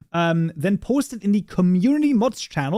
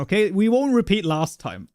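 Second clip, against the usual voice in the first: ordinary talk, nothing marked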